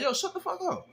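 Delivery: high snapping shout, retold; mock yell. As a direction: cut him off hard. Backing up passenger angrily.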